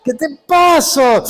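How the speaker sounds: high, slow, stretched question; alarmed retort. Retold or imitated. Pastor straightening the howling young man, slow high alarmed question.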